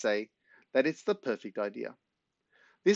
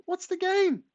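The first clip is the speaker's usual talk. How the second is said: put-on small boy; slower than usual. A narrator's reading. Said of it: "says Corey".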